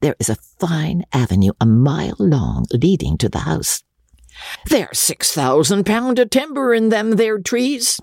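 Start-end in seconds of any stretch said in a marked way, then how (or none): 4.7-8.0 put-on high, rustic old squire voice; gruff old baronet. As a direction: play the coarse country baronet boasting. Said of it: "said Sir Pitt".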